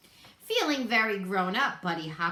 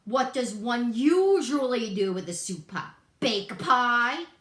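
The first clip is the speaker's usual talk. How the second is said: louder sarcastic villain voice; rough, louder varmint voice; unkind varmint; 4 semitones higher, 7 dB louder than usual. A narrator's reading. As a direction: sneer it at the little rabbit. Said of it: "not too kindly".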